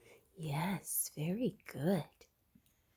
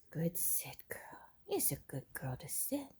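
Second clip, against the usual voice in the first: whispered praise; 9 dB quieter than usual. Real, not acted. Owner softly whispering praise to the sitting puppy. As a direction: whisper the praise close to her.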